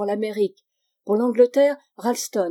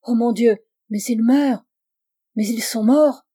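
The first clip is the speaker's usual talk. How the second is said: louder, horrified exclamation; horrified realisation; 2 semitones higher, 6 dB louder than usual. A narrator's reading. Girl sees her companions dying, horrified exclamation. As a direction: cry out as the horror sinks in.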